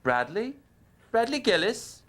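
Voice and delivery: high questioning woman's voice; imitated female caller. Woman waking Brad by phone, imitated female voice. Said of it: "woken up by this female voice".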